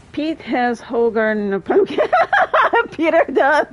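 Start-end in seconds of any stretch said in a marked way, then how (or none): 2.5-3.7 high, loud giggling through the words; laughing. Real, not acted. Streamer laughs at a chat pun, amused. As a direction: crack up at the joke.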